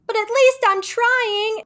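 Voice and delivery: high, slow, loud mock-defiant Monica; plucky Monica. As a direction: draw it out high like a proud underdog. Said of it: "And then she goes".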